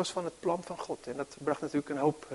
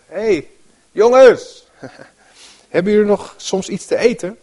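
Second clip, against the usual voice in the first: much higher and louder "Hey, jongens", chatty and laughing; casual mock call; 7 semitones higher, 10 dB louder than usual. Shown as a demonstration. Preacher playing Jesus hailing friends, breezy and laughing. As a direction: call out to the boat like to mates. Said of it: "In de andere vertalingen staat eerst nog wel van".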